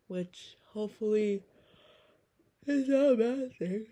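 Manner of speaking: words stretched by a yawn. Real, not acted. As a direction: say it mid-yawn. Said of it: "I'm sorry if I'm yawning".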